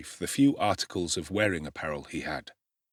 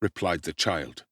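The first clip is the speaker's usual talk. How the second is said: ordinary delivery, nothing marked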